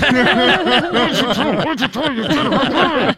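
slow, high voice through closed lips, laughing; ventriloquist talk. Retold or imitated. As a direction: drag it out through a fake smile. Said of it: "trying to do the ventriloquist thing".

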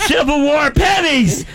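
very high, slow mocking squeal; mock excitement. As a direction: gush like an overexcited treasure hunter. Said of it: "get all excited".

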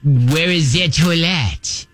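put-on French accent. Fake French expert showing a learner, mock French accent. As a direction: stretch it out in a hammed-up French accent. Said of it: "English words in a French accent".

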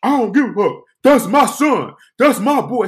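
retold defiant yelling; acted-out angry arguing. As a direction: argue back loudly, staking your claim. Man argues back in quarrel.

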